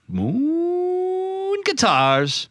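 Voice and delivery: very slow, high sung-out name; drawn out. Pretend. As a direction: stretch the name long and high.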